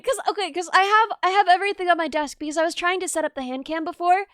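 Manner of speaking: normal talking voice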